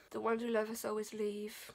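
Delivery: ordinary delivery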